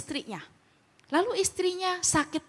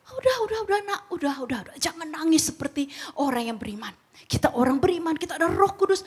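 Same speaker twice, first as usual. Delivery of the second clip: mock pious consoling; 5 semitones higher, faster than usual.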